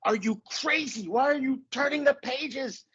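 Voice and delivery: high-pitched, frantic puppet voice; Grover. Acted. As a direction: plead with the reader in an exasperated cartoon voice. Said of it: "I would do the Grover voice".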